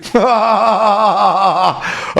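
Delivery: long rolling laugh. Real, not acted. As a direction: burst out laughing at your own joke. Laughing at own kissing joke. (long éclat de rire)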